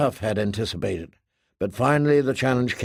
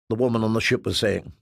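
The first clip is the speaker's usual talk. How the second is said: usual speaking voice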